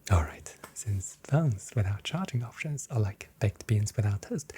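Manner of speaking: usual speaking voice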